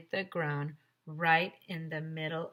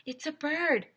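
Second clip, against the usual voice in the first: yelled; 10 semitones higher than usual. Acted. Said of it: "yelled Michael".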